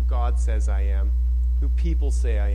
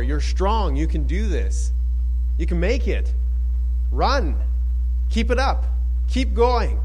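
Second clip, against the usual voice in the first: building cheer; 6 semitones higher than usual. Pretend. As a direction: cheer rising higher toward keep going. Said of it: "he's cheering you on".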